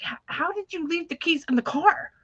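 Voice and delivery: high-pitched imitation of an exasperated father; mock frustrated dad. Dad confronting his daughter about her keys, in imitated frustration. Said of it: "get really frustrated with me".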